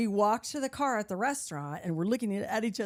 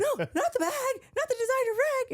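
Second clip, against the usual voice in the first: much higher, louder hammed-up horror; mock panic; 4 dB louder than usual. Hearing he lost the Prada bag, a hammed-up panicked shriek.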